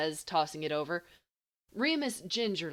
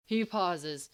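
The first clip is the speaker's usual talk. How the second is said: ordinary delivery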